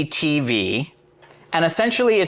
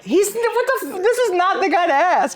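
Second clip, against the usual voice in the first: shocked disbelief; 12 semitones higher than usual. Retold or imitated. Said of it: "I was like".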